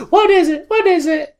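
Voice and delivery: very high squeaky mock voice; eager housemate, imitated way up high; Jasmine. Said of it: "Jasmine runs up the stairs and is like".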